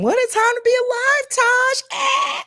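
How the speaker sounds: put-on high squeaky gushing girlfriend voice; very high breathless gush; gushing friend. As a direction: mimic a friend swooning over the album. Friend gushing, quoted by teller.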